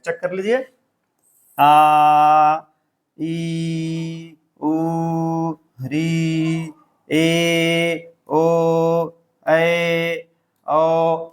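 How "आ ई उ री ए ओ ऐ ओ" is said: each vowel held steady and long. Shown as a demonstration. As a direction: sustain every vowel evenly. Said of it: "आप देर तक बोलेंगे". (हर स्वर को देर तक खींचकर बोलना)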